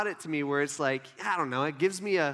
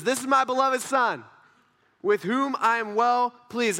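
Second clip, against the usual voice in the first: loud, higher proclamation; audible heavenly voice; 4 semitones higher, 5 dB louder than usual. Acted. Voice from heaven declaring a beloved son, loud and proud. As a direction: announce it boldly, drawing out the close. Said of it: "An audible voice from heaven".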